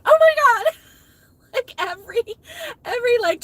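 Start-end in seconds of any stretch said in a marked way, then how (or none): 0.0-0.7 high, loud exasperated yell. Retold or imitated. Telling viewers how she yelled in the break room, loud exasperated cry. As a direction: cry it out like a frazzled teacher. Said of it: "like, yelled out".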